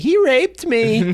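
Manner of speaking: high, drawn-out mock accusation; slow, high-pitched fake outcry; mock accuser. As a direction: play a hammed-up accuser. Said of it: "then you're like".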